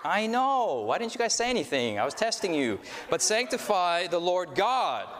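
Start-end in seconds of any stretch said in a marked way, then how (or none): 3.1-5.1 God held long and falling at the end; stress on God. Shown as a demonstration. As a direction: lean slowly into the word God. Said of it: "when you emphasize each word".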